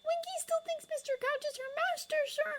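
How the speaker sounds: very high earnest elf voice; Dobby the house elf. Acted. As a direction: plead Winky's case.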